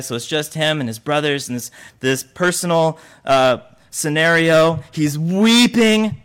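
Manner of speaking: normal talking voice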